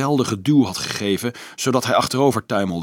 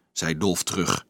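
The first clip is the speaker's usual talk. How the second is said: plain reading voice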